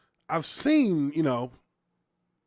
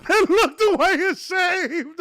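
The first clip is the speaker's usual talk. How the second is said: laughing; 8 semitones higher, 6 dB louder than usual. Real, not acted. Reading funny comment, laughing.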